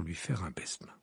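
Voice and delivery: breathless whisper. A narrator's reading. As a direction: drop to a hush. Narrator drops to whisper.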